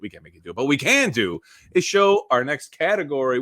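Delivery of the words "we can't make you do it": fading to a murmur. Acted. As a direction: trail off almost to nothing. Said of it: "repeated phrase, second take fading".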